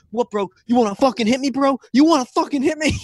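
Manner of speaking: mocking a bro shoving another in the theater. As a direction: imitate a bro picking a fight. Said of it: "he's like".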